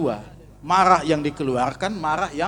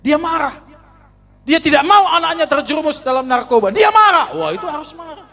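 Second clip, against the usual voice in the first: much higher and louder, fervent pulpit cry; preaching at full force; 12 semitones higher, 7 dB louder than usual. Acted. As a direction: exclaim it to the whole congregation. Preacher shouting the point. (khotbah berapi-api, suara tinggi dan keras)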